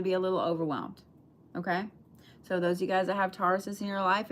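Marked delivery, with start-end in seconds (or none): none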